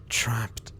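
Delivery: breathy whisper of guilt. Acted. Jack whispering his guilt over Renfield's death. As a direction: breathe the word out, haunted.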